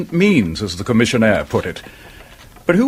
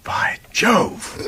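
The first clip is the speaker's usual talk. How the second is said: soft breathless whisper of surprise; whispered exclamation; 7 semitones higher, 4 dB quieter than usual. Acted. Inspector reacts to the detective's discovery in a whisper. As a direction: gasp the oath under your breath. Inspector struck by sudden realisation.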